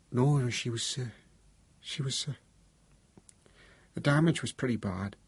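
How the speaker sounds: shaken, halting, unfinished words; faltering. A narrator's reading. Father asked if he saw his dead daughter, faltering. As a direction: break off and search for words.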